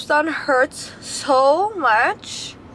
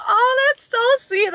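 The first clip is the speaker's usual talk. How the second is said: touched; 11 semitones higher, slower than usual. Retold or imitated. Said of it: "I'm like".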